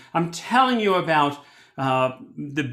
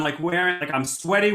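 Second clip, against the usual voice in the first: ordinary talk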